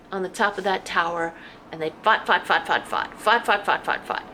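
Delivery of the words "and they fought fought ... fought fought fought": fought, fought, fought hammered loud and steady; rhythmic loud repetition of fought; repeated punch. Acted. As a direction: pound each fought like a drumbeat.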